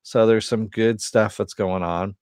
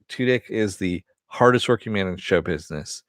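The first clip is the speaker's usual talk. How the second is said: ordinary talk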